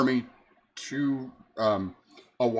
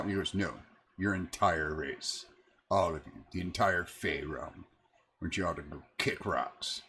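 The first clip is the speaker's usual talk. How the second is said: water elemental; 8 semitones lower, 8 dB quieter than usual. Acted.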